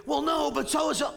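mock whiny kid talking back to dad; kid protesting. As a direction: pitch jumps up in a mock protest.

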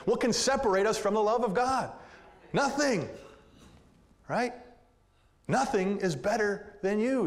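impassioned pulpit voice; full-force preaching. Acted. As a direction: drive the verse home to the congregation. Preaching climax on Romans eight.

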